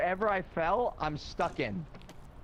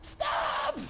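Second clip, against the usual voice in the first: pleading high-pitched cry; high yelp. Real, not acted. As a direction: squeal it out, begging. Begging the ghost to stop.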